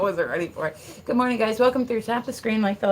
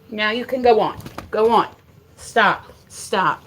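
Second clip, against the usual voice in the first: loud, firm dog command; real exasperated command, voice raised; firm scolding; 3 semitones higher, 8 dB louder than usual. Real, not acted. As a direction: order the dog off loudly. Ordering the dog away.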